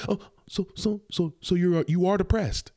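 lightly voiced mock-doubtful woman, high; a hint of a sceptical host. Mocking the woman's doubting question.

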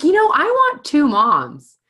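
put-on high, loud little girl whining; child voice. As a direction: whine it like a small daughter. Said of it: "she's like".

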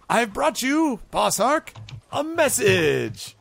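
high-pitched, ceremonious goblin messenger; pompous high herald voice; goblin envoy, proud. Acted. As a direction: announce it grandly, drawing out the last words.

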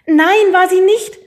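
loud high slow outcry; called out. A narrator's reading. Hidden child gives himself away to the headmistress, calling out loud. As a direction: blurt it out from hiding. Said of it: "rief ich".

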